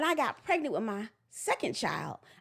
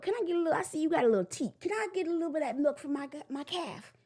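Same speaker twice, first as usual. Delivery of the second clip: high, fast pleading cow voice; a cow sweet-talking a goat for milk; cow asking a favor; 6 semitones higher than usual. Pretend. Cow begging the goat for milk, high coaxing voice. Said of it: "a cow going over to a goat and be like".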